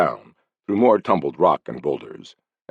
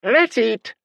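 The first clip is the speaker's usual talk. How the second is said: very high, squawking parrot; sharp parrot yell. Acted. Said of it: "a sharp voice yelled".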